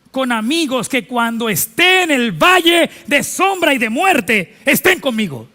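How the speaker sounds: loud, high, slow preaching voice; full-force preaching. Acted. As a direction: proclaim it to the whole church.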